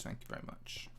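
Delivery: "Thank you very much" whispered with no voice. Real, not acted. A whispered echo of his own thanks to viewers.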